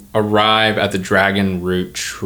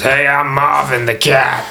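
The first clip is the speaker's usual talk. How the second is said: slow, drawn-out talking-cat voice, pitched up; Marvin the cat; 5 semitones higher, 3 dB louder than usual. Pretend. Game master as a cat meeting a player, slow put-on cat voice. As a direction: introduce yourself as a slow, strong-voiced cat. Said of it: "He goes".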